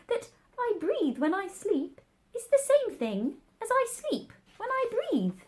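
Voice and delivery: very high, drowsy little mouse voice; sleepy Dormouse. A narrator's reading. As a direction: murmur it as if talking in sleep. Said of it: "talking in his sleep".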